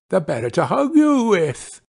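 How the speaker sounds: high, put-on granny reply; wolf as grandmother. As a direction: coax as the false grandmother.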